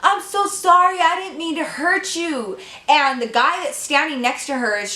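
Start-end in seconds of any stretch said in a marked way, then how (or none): 0.0-2.6 higher pleading apology, imitating the sister. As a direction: beg forgiveness in a raised voice. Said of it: "And Ariel's like".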